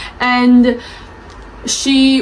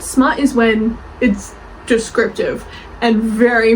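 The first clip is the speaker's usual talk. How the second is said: usual speaking voice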